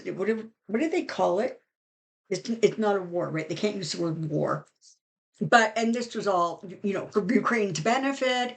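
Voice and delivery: usual speaking voice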